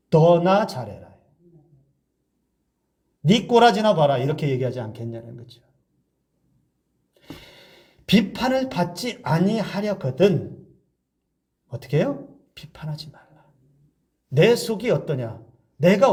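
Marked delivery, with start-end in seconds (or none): 0.1-4.2 mock retort. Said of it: "그 사람이 나한테 뭐라고 하겠어요?". 12.5-13.3 hushed, breathy scripture line; whisper. Acted.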